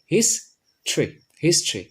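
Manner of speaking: হিস্ট্রি as "his... tri", then joined; unaspirated t after s in হিস্ট্রি, first split, then whole; correct pronunciation. Shown as a demonstration. Teacher showing the hard t to a student, হিস্ট্রি as "his-tri". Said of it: "সুতরাং এটা হবে".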